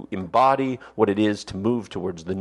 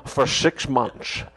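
Connery lecturing about his dedication, lightly voiced; Sean Connery impression. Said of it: "that was Sean Connery".

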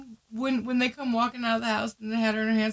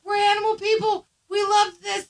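put-on high-pitched, keen old lady; eager old lady; 7 semitones higher, 4 dB louder than usual. Old lady reassuring the dog's owner, eager. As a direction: gush as a helpful animal lover.

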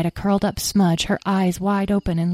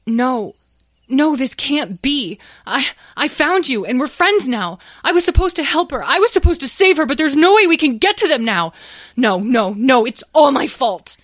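anguished despair; 8 semitones higher, 5 dB louder than usual. Acted. Tom despairs, Talora may die.

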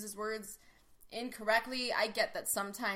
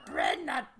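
Yoda; 2 semitones higher than usual. Acted. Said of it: "answered her in Yoda accent".

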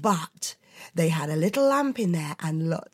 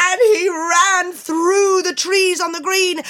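much higher, louder panicked narration; excited shouting; 12 semitones higher, 8 dB louder, slower than usual. A narrator's reading. Narrator shouting as Leo runs screaming from the figure. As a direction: tell it breathless as Leo flees.

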